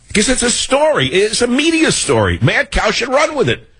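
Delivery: high-pitched exasperated insistence; indignant rant. Real, not acted. Host demands a rival run the media story, indignant and high. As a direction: push the voice way up in protest.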